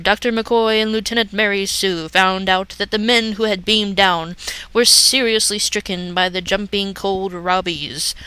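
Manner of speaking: plain reading voice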